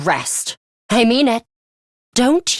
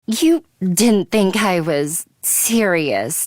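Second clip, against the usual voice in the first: normal talking voice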